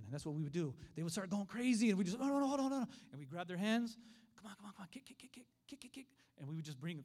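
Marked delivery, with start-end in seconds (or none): 2.2-2.9 high, loud, rapid-fire reassurance; urgent steadying. Retold or imitated. Preacher as teacher grabbing kids' hands, urgent. Instructor steadies panicking child. 4.4-6.1 soft, high, whispery chant; hushed coaching. Retold or imitated. Preacher as teacher leading kids by the hands, hushed. Instructor coaches child to kick.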